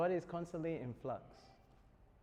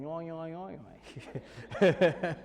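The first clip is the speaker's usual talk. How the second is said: put-on a jokey garble of an Australian accent, the last yoing much louder; mock Australian gibberish; 3 semitones higher, 9 dB louder, much slower than usual. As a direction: parody how foreigners hear thick Australian. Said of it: "They just thought I was saying".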